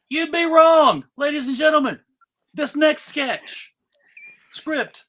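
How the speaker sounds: usual speaking voice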